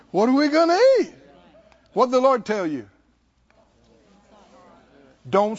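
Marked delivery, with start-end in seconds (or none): 0.1-1.1 loud, high, hurried worried question; worried complaint. Acted. Congregant tempted to worry about food, loud fretful complaint. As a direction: fret it out quickly and loudly.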